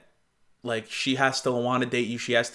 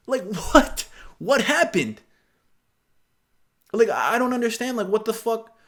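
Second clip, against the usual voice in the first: put-on higher, incredulous valley-girl whine; high mock disbelief; mock outrage; 8 semitones higher, 3 dB louder than usual. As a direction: play the baffled, offended friend.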